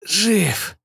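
hoarse wheezed reply; wheezing. Acted. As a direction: rasp it out short of breath. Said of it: "просипел пилот".

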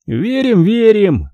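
high, sneering mock agreement; a bully taunting Greg, high and mocking. A narrator's reading. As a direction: jeer it back at him, pitched high. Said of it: "насмешливо сказал Микки".

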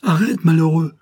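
loud, high cry of alarm; appalled, urgent outcry; horrified cry. A narrator's reading. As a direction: shout to stop him in dread. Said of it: "épouvanté".

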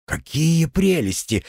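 high, slow baffled question; taken aback. A narrator's reading. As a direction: ask it stunned and slow. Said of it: "опешил Артём".

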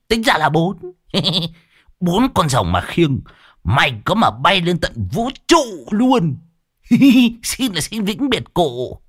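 high voice broken by giggles; gleeful mock farewell, pitched high; taunting mock mourner. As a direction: tease him about his funeral, cackling between phrases.

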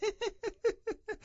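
shrill giggle at his cousin; high mocking laugh. Linton laughs at Hareton, high and soft. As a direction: laugh at the victim.

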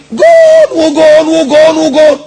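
high, loud, long-held goal cries; screaming goal call. Acted. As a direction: imitate a commentator screaming each goal. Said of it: "ويزعق ويصرخ". (صراخ معلق عند الهدف)

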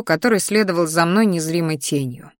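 usual speaking voice

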